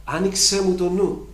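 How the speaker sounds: fervent prayer; fervent plea. Pretend. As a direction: beg him to open your mind. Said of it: "Δεν ξέρω τίποτα".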